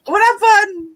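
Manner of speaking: high alarmed what happened; sudden worried outburst; startled cry. Real, not acted. Startled, crying out mid-reading.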